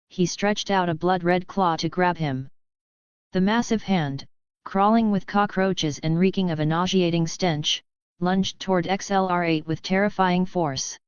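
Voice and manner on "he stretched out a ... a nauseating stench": usual speaking voice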